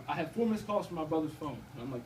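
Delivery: ordinary talk, nothing marked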